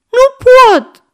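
very high, loud bawl; child's yell. A narrator's reading. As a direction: bawl from behind a locked door. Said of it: "zbiară Goe dinăuntru". (zbierăt de copil)

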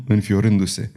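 plain reading voice

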